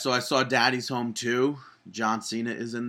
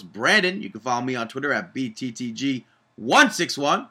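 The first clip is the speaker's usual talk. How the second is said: ordinary delivery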